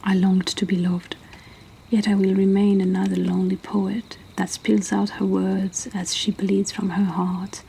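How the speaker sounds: usual speaking voice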